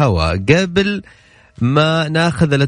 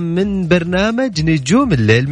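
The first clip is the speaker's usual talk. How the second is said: radio host intro; 7 semitones higher than usual. Acted. Radio host opens the show.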